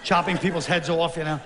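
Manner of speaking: usual speaking voice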